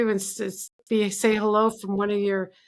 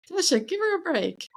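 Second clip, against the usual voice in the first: much higher laughing "Tasha, give her a break"; coaxing the dog; 6 semitones higher than usual. Real, not acted. Calling a friend's dog over, high laughing coax.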